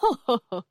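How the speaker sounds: short performed laugh; brief, deliberate ha-ha laugh; acted laugh. A narrator's reading. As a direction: narrator voices a character's chuckle. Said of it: "ضحك البرق وشد جذعه وقال".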